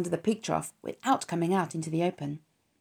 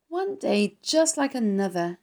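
put-on slow, mildly groaning young pig voice; a lonely pig sighing at the rainy day; 4 semitones higher than usual. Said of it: "he groaned".

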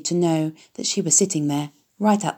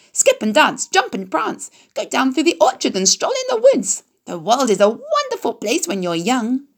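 very high honking goose voice; 5 semitones higher than usual. A narrator's reading. Goose telling the pig where to roam, very high and giddy.

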